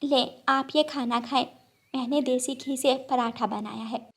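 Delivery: a hostess sparrow offering parathas; kind sparrow. Acted. (नरम, मनाती चिड़िया की आवाज़)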